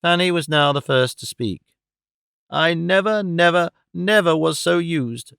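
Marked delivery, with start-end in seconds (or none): none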